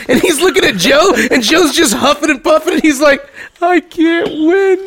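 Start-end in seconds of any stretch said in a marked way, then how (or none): 3.6-4.8 very slow and higher, whining; defeated whine. Retold or imitated. Friend facing Miguel and Joe, slow defeated whine. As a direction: drag it out like a beaten man.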